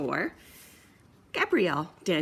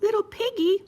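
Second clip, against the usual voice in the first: much higher, louder; high brother pig; 14 semitones higher, 4 dB louder than usual. Acted. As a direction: tease the piggy. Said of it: "said the brother".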